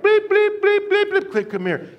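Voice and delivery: high, loud mock whistle bleeps; shrill out repeated bleeps like a coach's whistle; imitated whistle blasts. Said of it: "He'd be like".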